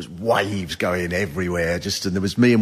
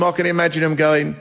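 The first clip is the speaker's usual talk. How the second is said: usual speaking voice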